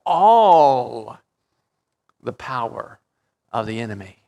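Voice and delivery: emphatic underlining of All; stress on all. Shown as a demonstration. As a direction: lean hard on All, let the rest fall away. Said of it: "I like to underline that word all".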